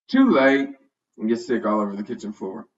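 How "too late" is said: louder, lightly whimpered words; mild whimper. A narrator's reading. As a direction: whimper like a queasy dog. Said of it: "Tucks whimpers".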